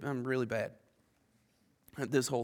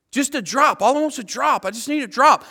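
higher, louder pleading for a drop; begging rich man; 7 semitones higher, 7 dB louder than usual. Retold or imitated. Rich man in hell begging for a drop, pleading loudly. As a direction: beg like a man in torment.

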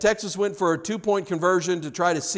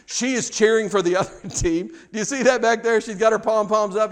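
usual speaking voice

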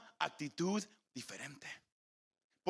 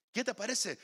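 normal talking voice

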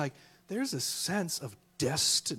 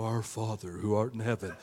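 lower, solemn cathedral tone; formal King James prayer; 9 semitones lower, 4 dB quieter than usual. Retold or imitated. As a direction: pray it in stiff old English. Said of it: "with your cathedral New King James".

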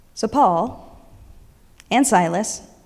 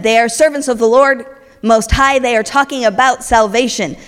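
loud repeated proclamation; hammed-up shouting possessed woman; yelling woman; 6 dB louder than usual. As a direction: keep shouting it. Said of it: "keeps yelling over and over".

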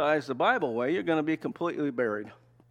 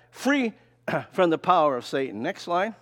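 usual speaking voice

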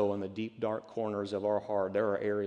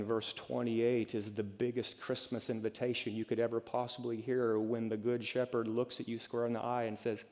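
ordinary talk

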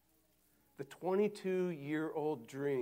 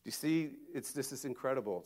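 normal talking voice

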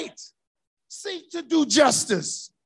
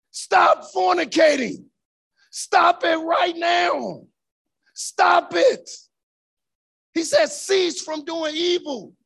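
preaching at full force; 4 semitones higher, slower than usual. Acted. Preacher shouting at his congregation to stop sinning, full force. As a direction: hammer the commands home to the congregation.

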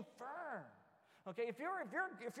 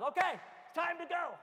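put-on loud, raised voice calling the kids; parent ordering kids; 7 semitones higher, 6 dB louder than usual. Dad telling his kids to get ready for church, loud and firm. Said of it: "then the time comes".

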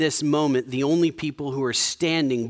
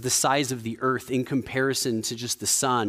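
ordinary talk, nothing marked

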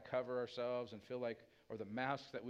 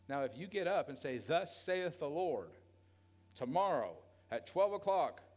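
loud, higher, fervent preaching voice; full-force preaching; 5 semitones higher, 5 dB louder than usual. Acted. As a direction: drive it home to the congregation.

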